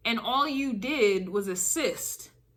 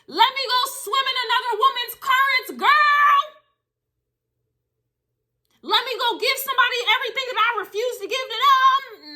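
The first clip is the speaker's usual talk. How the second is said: mock whining; 11 semitones higher, 4 dB louder than usual. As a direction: put on a silly, whiny high voice. Mocking what you didn't do instead.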